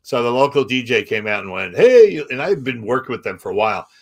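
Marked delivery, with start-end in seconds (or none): 1.8-2.2 loud, high crowd-warming "Hey"; DJ hype shout. Retold or imitated. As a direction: call out to the crowd like a radio DJ. DJ hyping crowd at concert.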